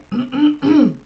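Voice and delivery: loud throat clear. Real, not acted. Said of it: "I love how my throat is clearing".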